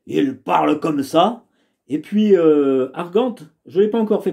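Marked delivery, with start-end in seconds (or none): 0.1-1.4 sly servant voice, lightly put on; valet voice. Shown as a demonstration. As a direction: give it a quirky valet's voice. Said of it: "je lui ai donné une voix un peu particulière".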